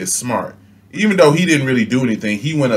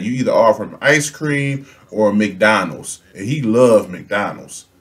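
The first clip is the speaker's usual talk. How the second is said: normal talking voice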